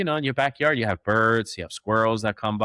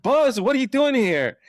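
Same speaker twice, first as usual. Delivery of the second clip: much higher, louder amazed call to the runaway dog; high, loud and startled at finding the dog loose; shocked disbelief; 13 semitones higher, 4 dB louder than usual. Retold or imitated. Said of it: "I'm like".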